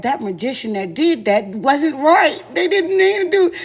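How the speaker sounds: half-laughing creature impression; mocking a creature that can't talk right. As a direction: drag the words out like a tongue-tied beast. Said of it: "he looked like he talked like that".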